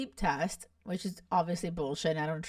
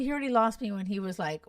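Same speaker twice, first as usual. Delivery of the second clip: ordinary delivery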